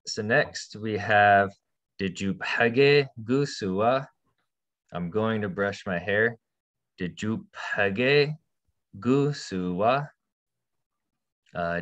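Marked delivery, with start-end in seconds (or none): 2.0-4.1 slow and clear, each word separate; slow model read. Shown as a demonstration. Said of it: "teacher models the sentence". 7.0-10.1 slow and clear, each word separate; slow model read. Shown as a demonstration. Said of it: "teacher models the sentence".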